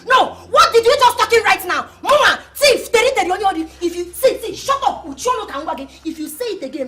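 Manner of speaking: usual speaking voice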